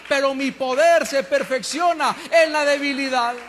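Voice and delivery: stirring sermon delivery. Acted. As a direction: build the call to the congregation. Preaching at full force.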